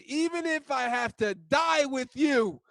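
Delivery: slow, high, shouted vow; fervent shout. Acted. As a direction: cry out the vow as if ready to die. Peter's extreme vow.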